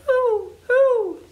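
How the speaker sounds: high loud owl hooting. Shown as a demonstration. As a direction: hoot like an owl. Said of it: "And what do owls say?".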